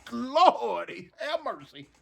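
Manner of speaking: normal talking voice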